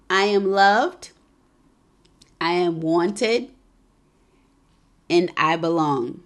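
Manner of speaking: slow, deliberate affirmation. Acted. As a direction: lead the sisters in affirmations, word by word. Leads affirmations.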